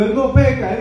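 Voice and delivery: high, firm proclamation; angel proclaiming. Retold or imitated. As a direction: declare it like a seraph. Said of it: "Está diciendo".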